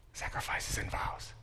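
soft whispered answer. Acted. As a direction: breathe the words out, barely voiced. Hushed answer to own question.

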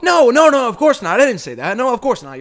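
loud, high burst of backpedalling; frantic denial. Acted. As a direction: deny it in an acted fluster. Acts out backpedalling to the wrestler.

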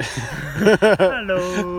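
loud, high, dopey greeting; goofy friendly giant. Pretend. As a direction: boom out a dopey hello. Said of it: "Big friendly Ian".

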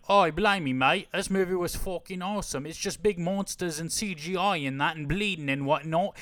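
hammed-up British accent, raving; British bloke. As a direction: gush like an excited British fan. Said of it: "people who are like".

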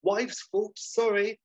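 high, hurried-sounding excuse; lying hotelier. Acted. Basil lying to the appraiser, high-pitched excuses. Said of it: "and Basil lies to him".

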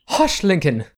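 high, sharp hush; hushing lady. Acted. Noblewoman hushes her brother Lincoln, high. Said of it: "the woman standing next to him says".

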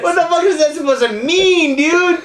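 high, laughing protest; amused indignation. Real, not acted. Friend objects to being dragged into joke, laughing indignation.

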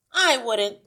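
loud, high interruption; ratty. A narrator's reading. Wife interrupts, annoyed at lost TV sound, ratty. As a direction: cut in irritably at full volume. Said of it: "She was still ratty".

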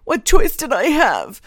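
high, loud anguished wail; desperate, tearful outburst; sobbing despair. A narrator's reading. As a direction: cry out as you fall apart. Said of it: "sobbing, Stahl was falling apart".

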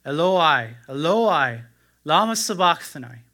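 crying out loud and high; anguished loud cry from the cross. A narrator's reading. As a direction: call out in anguish to God. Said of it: "Jesus cried with a loud voice".

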